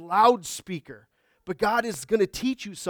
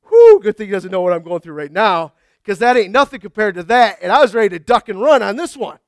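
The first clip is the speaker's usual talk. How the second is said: relieved whew; 2 semitones higher, 12 dB louder than usual. Retold or imitated. Relieved exclamation after compliment.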